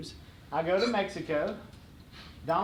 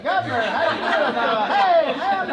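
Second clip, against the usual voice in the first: high, loud, stalling hello; overeager greeting; 8 semitones higher, 8 dB louder than usual. Retold or imitated. Stalling the governor on the phone, overeager loud greeting. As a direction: greet him too heartily while stalling.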